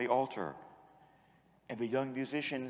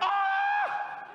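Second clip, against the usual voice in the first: high loud mock scream of awe; imitated scream; 5 dB louder than usual. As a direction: shriek like a startled teen. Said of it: "and they went".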